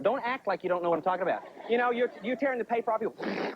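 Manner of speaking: ordinary delivery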